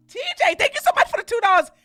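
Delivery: high, loud, rapid-fire gratitude; excited thanks. Real, not acted. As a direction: rattle off a squealed thank-you. Gushing thanks for donation.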